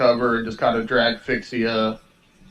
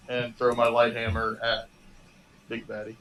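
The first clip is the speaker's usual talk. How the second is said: ordinary talk, nothing marked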